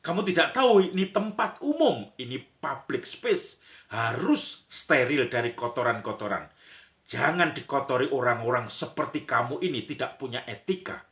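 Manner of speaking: high, loud scolding, easing toward the end; indignant yell in a staged argument; acted shouting quarrel. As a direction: play someone berating a litterer in public. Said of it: "langsung teriak".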